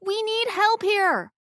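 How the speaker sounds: high, loud, slow call; yelled. Acted. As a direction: bellow it so they hear. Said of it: "Bernie yelled".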